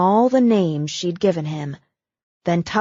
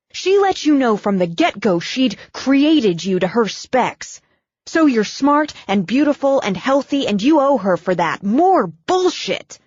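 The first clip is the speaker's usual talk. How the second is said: louder, heated tirade rising high; building rant; 6 semitones higher, 4 dB louder than usual. Acted. Brooke railing at Abigail's cold mother, heated and loud. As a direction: tear into the mother, voice climbing.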